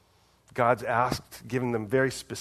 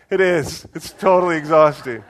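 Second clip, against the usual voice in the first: louder and higher, cheerfully confessing it is exhausting; emphatic weary admission; 4 semitones higher, 10 dB louder than usual. Retold or imitated. Pastor to his spiritual director, loudly admitting exhaustion. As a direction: blurt the admission with a laugh in it.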